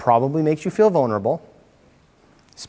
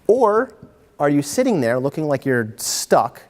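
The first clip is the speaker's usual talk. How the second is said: usual speaking voice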